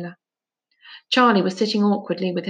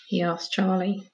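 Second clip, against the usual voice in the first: ordinary delivery, nothing marked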